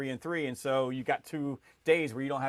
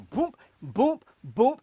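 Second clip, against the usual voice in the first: loud, higher "boom, boom"; imitated scanning thumps; 8 semitones higher, 6 dB louder, much slower than usual. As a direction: punch out each failed scan. Imitates repeated failed scanning.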